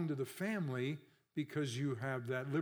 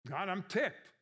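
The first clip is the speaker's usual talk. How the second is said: higher, mock-indignant complaint to God; ticked-off Job; 5 semitones higher, slower than usual. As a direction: blurt it out like a man fed up. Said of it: "Those are his expressions".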